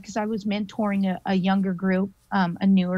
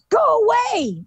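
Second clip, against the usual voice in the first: yelled go away; 4 dB louder than usual. Retold or imitated. Woman to the hag hovering over her, high yell. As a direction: yell to drive it off. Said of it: "like that".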